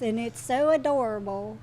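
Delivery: normal talking voice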